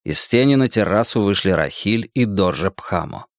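normal talking voice

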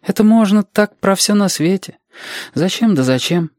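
put-on high child voice; objecting child. Reshka objecting to Alexander's endless whys, high child voice. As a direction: protest lightly in a child's high pitch.

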